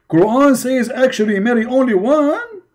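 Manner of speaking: high, incredulous mock quote; mock disbelief. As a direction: echo their claim high, in disbelief. Repeats the claim in mockery.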